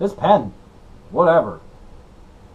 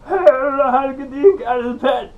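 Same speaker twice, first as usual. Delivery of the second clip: much higher, loud frantic comic voice; Bobcat Goldthwait impression; 12 semitones higher, 4 dB louder than usual. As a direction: whine it like a flustered stand-up. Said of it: "done a Bobcat Goldthwait impression".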